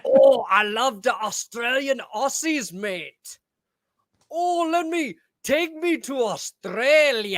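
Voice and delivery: high, drawn-out fake Australian; bad Australian accent. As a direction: overdo a terrible Australian accent. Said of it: "I can't do impressions".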